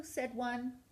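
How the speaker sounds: ordinary delivery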